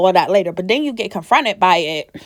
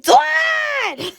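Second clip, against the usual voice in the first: high shouted call of his name; shrieked high pitched name call. Pretend. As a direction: yell for Dawn across the house. How she'd call out to Dawn.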